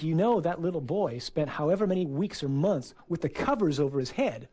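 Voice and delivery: ordinary delivery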